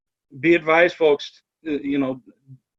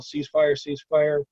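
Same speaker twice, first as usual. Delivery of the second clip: normal talking voice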